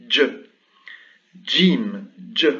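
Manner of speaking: voiced "Dj", tongue stop then buzz; a crisp "Dj" as in Arabic; Arabic jim sound. Shown as a demonstration. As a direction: say "Dj" like the d-j in "jeans". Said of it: "se prononce Dj".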